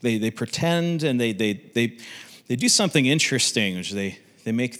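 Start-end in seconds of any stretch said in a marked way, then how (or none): none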